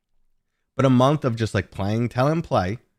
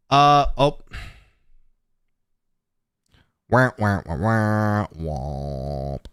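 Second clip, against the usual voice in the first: sad trombone; much slower than usual. Pretend. Mocking own audio failure.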